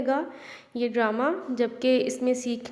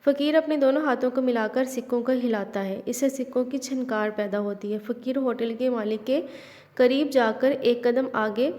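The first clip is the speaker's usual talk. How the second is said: ordinary delivery, nothing marked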